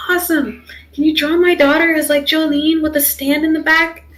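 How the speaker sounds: retold excited request. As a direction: pitch it up like someone thrilled to ask. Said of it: "she was so excited".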